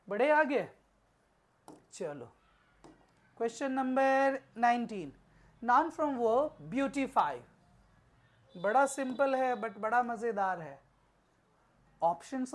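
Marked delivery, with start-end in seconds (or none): 5.6-7.3 very slow, louder question read; teacher announces question nineteen; reading the question aloud. Shown as a demonstration. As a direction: read "beautify" out slowly. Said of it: "reading the question".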